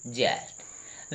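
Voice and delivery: ज्येष्ठ breathed slowly and softly; slow soft correct form. Shown as a demonstration. Teacher modelling the right word quietly, slow. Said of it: "क्या होना चाहिए?".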